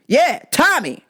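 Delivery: short high-pitched yelled name; mock yelling customer. Customer demanding his order by name, mock yelling. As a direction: yell it like an oddball customer. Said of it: "why are you yelling".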